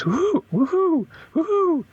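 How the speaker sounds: high hooting woohoo, falling at the end; high falling hoots, woo-hoo; imitated owl-like call. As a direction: hoot it high like a joyous call in the woods. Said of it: "she imitates it".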